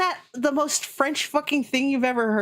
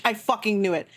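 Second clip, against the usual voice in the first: normal talking voice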